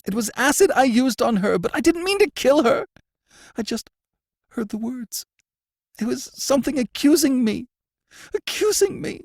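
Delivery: high, loud, frightened pleading; plead, frightened and guilty; a prisoner confessing to the warden, high and frightened. A narrator's reading. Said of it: "he mumbled".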